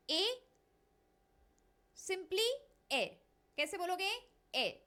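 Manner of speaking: ए said with no h sound; silent h. Shown as a demonstration. Teacher drilling the sound of heir. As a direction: say ए, no h at the start. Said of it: "इसको हम simply बोलते हैं ए".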